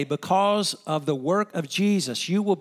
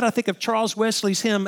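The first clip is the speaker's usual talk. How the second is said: usual speaking voice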